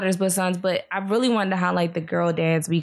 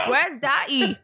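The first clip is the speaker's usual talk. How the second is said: higher, put-on Peppa Pig British accent with laughter; 6 semitones higher, slower than usual. Mom repeating her son's question to friends, Peppa Pig accent. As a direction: mimic a toddler's British cartoon accent, amused. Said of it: "this Peppa Pig accent".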